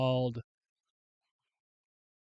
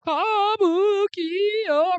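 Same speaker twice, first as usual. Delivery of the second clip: drawn-out high call; 8 dB louder, much slower than usual. Real, not acted. Drawn-out name in list.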